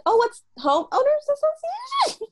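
very high-pitched put-on curiosity; mock fascination. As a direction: squeal it like an over-impressed listener. Voicing imagined impressed listeners.

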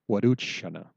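"Waduchana" falling at the end; teacher answering "Waduchana"; statement answer. Shown as a demonstration. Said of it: "if you answer back".

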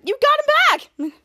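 high, loud happy exclaim; excited cheer. Retold or imitated. Henry thrilled Schwartz returned, excited cheer. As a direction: burst out with joy.

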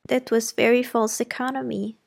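a hint of a fond old lady vouching for her; the housekeeper speaking up for her governess, mildly voiced; lightly voiced kindly old housekeeper. Fairfax remarks.

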